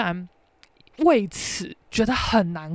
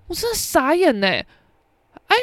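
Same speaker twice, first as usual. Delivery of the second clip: ordinary talk, nothing marked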